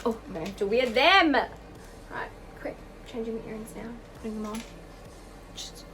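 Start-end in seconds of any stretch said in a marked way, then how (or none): 1.3-5.8 hushed aside. Real, not acted. Quick aside while changing earrings.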